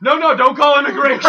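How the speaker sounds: loud, high mock-Mexican panic; Mexican accent. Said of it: "broke out of the Mexican".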